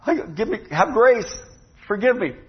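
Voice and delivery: high-pitched mock plea; pleading debtor. Fellow servant begs the man he owes, mock pleading. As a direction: beg for grace as the poor fellow servant.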